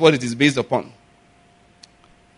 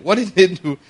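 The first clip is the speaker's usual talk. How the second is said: normal talking voice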